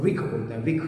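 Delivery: विख, the ष of विष turned into "kh"; low, measured विख with an aspirated kh ending; Sanskritist's विख in place of विष. Retold or imitated. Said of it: "खा से करते हैं".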